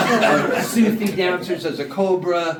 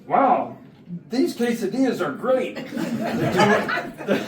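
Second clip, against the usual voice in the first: awkward nervous gush; 5 semitones higher than usual. Retold or imitated. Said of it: "all I do is I go".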